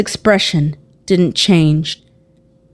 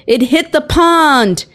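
raised-pitch exclamation at moderate volume; mild yell; 8 semitones higher than usual. A narrator's reading. Someone alerting the crowd to the crash, restrained yell. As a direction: cry it out high, drawing out the end. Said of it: "someone yelled".

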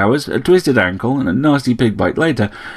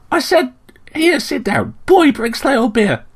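high-pitched, brisk bird voice; bossy sparrow. A narrator's reading. Sparrow orders beer for Slayle in the saloon, bossy. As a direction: order people about as a small bird.

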